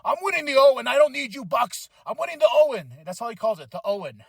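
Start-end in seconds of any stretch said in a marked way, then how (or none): 0.0-2.9 higher, intense mock Hangman. To the Bucks, hammed-up intense wrestler. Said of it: "the intensity Hangman has".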